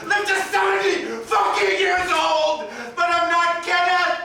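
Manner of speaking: loud, high, desperate shouting at God; furious rant. Acted. A grieving man raging at God over the dead boy, screamed. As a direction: rage at heaven at full voice.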